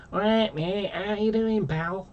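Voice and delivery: Scally twang from Manchester, admittedly not done well; Manchester Scally accent. Retold or imitated. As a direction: greet a mate like a Manchester Scally lad, half-hearted. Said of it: "the other version is like".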